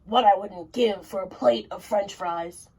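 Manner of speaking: a hint of a gruff giant, longing; lightly voiced giant. Mister Giant longing for French fries, lightly voiced giant. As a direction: sigh it out as the giant.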